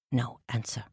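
ordinary delivery, nothing marked

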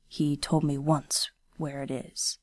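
lightly voiced stern young ronin, hushed; a hint of a guarded swordsman speaking low; quiet, restrained ronin voice; Kenishi, low and quiet.

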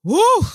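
high loud emotional woo; sudden high loud whoop of emotion; overwhelmed woo. Real, not acted. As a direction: let out a cry of feeling. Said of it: "I feel it".

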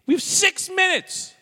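high, loud, very slow protest. Pretend. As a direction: drag it out in protest. Refusing to end the show.

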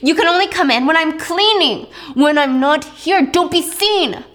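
high, loud scolding grandmother; angry scolding. Acted. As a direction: scold her like a furious matriarch.

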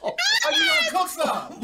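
high, loud teasing; high teasing shout. Real, not acted. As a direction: shout it with glee. Teasing Kim loudly.

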